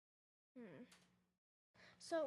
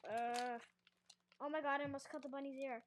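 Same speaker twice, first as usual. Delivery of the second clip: normal talking voice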